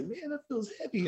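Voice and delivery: mimicking himself, high and straining under the bar; squeaky high pained mutter, half-joking; high strained mock whine. Lifter mimicking himself under a too-heavy bar, high strained whine. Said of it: "I was like".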